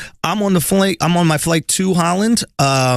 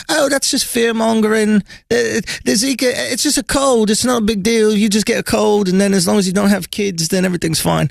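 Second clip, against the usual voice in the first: a lightly voiced English woman, higher and breezy; English woman imitation; 6 semitones higher than usual. Retelling the English girl at the airport, a light English accent impression. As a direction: mimic the English girl in an easy English lilt. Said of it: "She goes".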